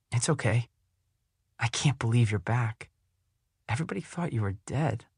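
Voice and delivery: put-on gentle, hushed young girl; soft girl voice. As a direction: say it softly as the girl. Cammy, softer.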